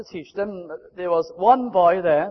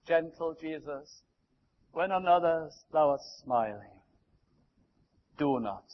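soft, slow, hushed prayer; quiet prayer; 4 semitones lower, 7 dB quieter, slower than usual. Acted. Preacher praying for the congregation, soft and hushed. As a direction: pray gently, nearly under the breath.

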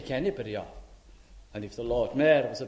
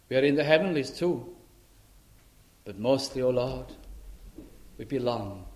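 prayer; 2 semitones lower than usual. Acted. Leads a prayer.

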